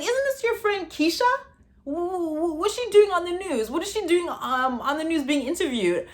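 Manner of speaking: high, flustered mom voice, stammering; shocked mom. Retold or imitated. Mom asks why the friend is on the news, high stammering shock. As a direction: stammer out the question like a stunned mom. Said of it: "She's like".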